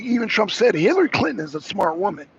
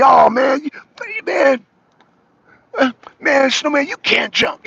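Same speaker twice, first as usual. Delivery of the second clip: higher, louder teasing taunt; mock trash talk; 6 semitones higher, 4 dB louder than usual. Teasing a friend playing basketball, higher mock taunt. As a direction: rib him like on the court.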